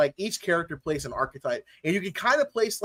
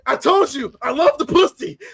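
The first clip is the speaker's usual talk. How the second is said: higher, louder hammed-up protest; mock shouted insistence; 8 semitones higher, 6 dB louder than usual. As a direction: protest it loudly.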